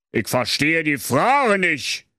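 slow, grumbling royal retort; mild blustering king. Acted. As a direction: bluster it at the hangman. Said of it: "polterte König Henner Kotelett ungehalten". (langsam polternder König)

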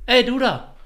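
put-on high, loud brash kid calling out to the teacher; cheeky kid. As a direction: bark it at the teacher like a rude little boy. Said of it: "guckt die Lehrerin an und macht so".

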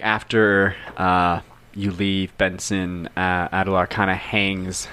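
ordinary talk, nothing marked

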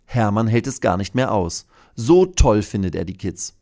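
usual speaking voice

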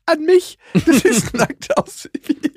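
put-on high child's voice breaking into laughter; imitating his daughter. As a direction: squeak it out like a proud little girl, laughing. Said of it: "Und die so".

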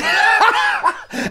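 loud, high burst of laughter; real laughter at a blunder. Real, not acted. Laughs at own mix-up.

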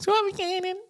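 very high squeaky cartoon voice; Mickey Mouse voice. Shown as a demonstration. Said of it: "ich hab immer wie Mickey Mouse geredet". (sehr hohe Mickey-Maus-Stimme)

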